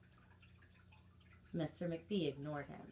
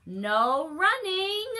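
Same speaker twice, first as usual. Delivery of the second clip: high, loud, slow call; drawn-out librarian call; calling after; 11 semitones higher, 12 dB louder, much slower than usual. Acted. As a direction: call the rule after him down the hall. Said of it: "Miss Merriweather called after him".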